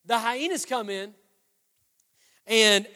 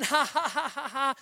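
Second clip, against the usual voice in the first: put-on "ha ha" cackle, raised pitch; mock hyena laughter; 3 semitones higher, 3 dB quieter than usual. Hyenas laughing at young Simba, mock laughter. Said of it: "they all start laughing".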